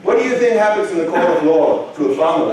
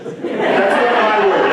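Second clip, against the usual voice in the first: slow, laughing through the words; slow laughing "That's not my word"; laughing disclaimer; 4 semitones higher, slower than usual. Real, not acted. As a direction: laugh it off, drawn out. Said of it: "I hear bullshit".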